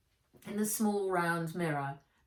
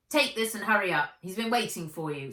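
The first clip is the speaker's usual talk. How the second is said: louder, fast, bossy scolding; grumpy cook; 3 semitones higher, 6 dB louder, faster than usual. Acted. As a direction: hurry the girl along, annoyed.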